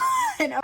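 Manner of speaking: a high burst of real laughter. Real, not acted. Laughs at own hatred.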